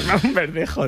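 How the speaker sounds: laughing as you repeat it; amused echo. Real, not acted. Wine expert teases guest over his choice, amused. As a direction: echo it back through a laugh.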